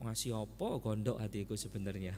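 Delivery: low, soft, sulky mutter under the breath; grudging inner grumble. Retold or imitated. Husband secretly sulking at his wife's question, low grudging mutter. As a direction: grumble it to yourself, resentful.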